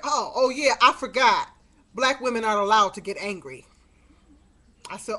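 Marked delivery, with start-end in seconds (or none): 0.0-3.7 loud, biting sarcasm; loud mock-innocent sarcasm; sarcastic retort. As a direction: throw it back at him mockingly. Sarcastic retort to her son.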